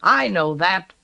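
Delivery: loud, sharp snap; snapped. A narrator's reading. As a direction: snap back at him. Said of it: "snapped Levick".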